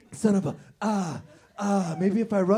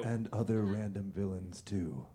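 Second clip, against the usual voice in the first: much lower, softer echo; low Batman voice; 9 semitones lower, 9 dB quieter than usual. Acted. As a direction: growl it back like Batman.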